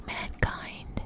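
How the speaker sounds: ordinary delivery, nothing marked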